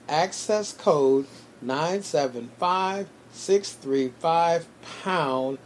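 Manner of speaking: digits drawn out very slowly, group by group; slow repeat. Real, not acted. Host repeating the call-in number for listeners, very slowly. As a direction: spell out the number slowly with pauses between groups. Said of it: "I'm gonna say that again".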